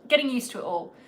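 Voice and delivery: ordinary talk, nothing marked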